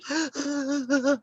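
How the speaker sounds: sharp high gasp; quick shocked intake of breath. Retold or imitated. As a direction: mimic a startled gasp. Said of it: "you know, like that".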